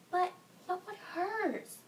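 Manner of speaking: high, slow, stammered question; acted mother, confused and still anxious; bewildered worry. Mother to wailing daughter, confused about her pain, worried.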